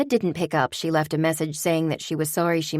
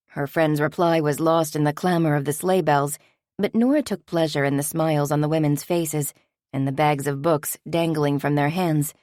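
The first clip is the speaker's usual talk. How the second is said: ordinary delivery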